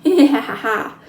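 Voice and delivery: scornful laughter at the fallen cowboy; Indian's short gloating laugh; mocking laugh. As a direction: laugh at him, amused and contemptuous. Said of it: "Little Bear lowered his bow and laughed".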